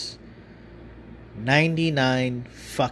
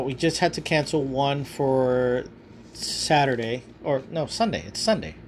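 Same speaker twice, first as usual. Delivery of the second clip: ordinary talk, nothing marked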